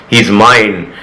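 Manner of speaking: ordinary delivery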